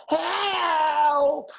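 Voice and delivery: high, loud cry as he slips on ice; cry for help. A narrator's reading. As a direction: yell for help while skidding. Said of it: "he cried".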